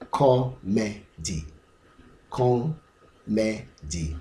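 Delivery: "Comedy" broken into three slow, separate syllables. Shown as a demonstration. Teacher splitting a word into three syllables, slow and separated. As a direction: say "Comedy" slowly, one syllable at a time. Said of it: "it's a three syllable".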